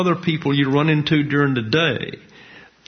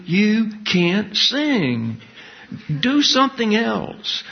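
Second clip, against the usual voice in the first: higher and slow, laying it out plainly; blunt judge; 8 semitones higher, slower than usual. Retold or imitated. Talent show judge telling a singer to quit, high and slow. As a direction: spell the verdict out slowly, up high.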